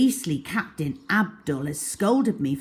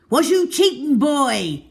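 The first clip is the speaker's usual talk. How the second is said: loud, high pirate howl; howling pirate teacher; 8 semitones higher, 7 dB louder than usual. A narrator's reading. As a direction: bellow it at the boy. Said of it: "howled Riff Raff".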